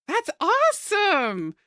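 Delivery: high, drawn-out delighted exclamation; thrilled. Real, not acted. Streamer praises viewer studying linguistics, thrilled.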